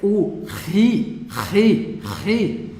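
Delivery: ri with a rough ch at the back of the throat; guttural ri. Shown as a demonstration. Teacher repeating the letter for students: throaty rasping ri. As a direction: growl ri out from the throat. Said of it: "ele tem esse sonzão aqui".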